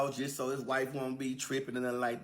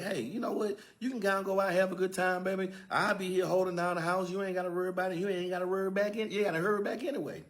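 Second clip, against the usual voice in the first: higher, overly sweet coaxing; butter her up so she leaves; mock-sweet husband hurrying his wife out the door; sweet-talking husband; 7 semitones higher than usual. Joe sweetly urging wife out.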